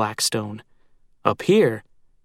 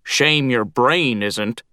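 slow, cackling crow voice; slower than usual. A narrator's reading. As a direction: cackle the insult like a gruff old crow. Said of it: "Glum cackled".

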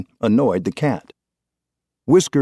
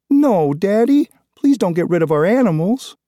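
put-on pleading little girl; 11 semitones higher, 4 dB louder than usual. A narrator's reading. Daughter begging father not to get rid of pets, high tearful plea.

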